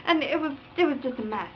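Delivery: normal talking voice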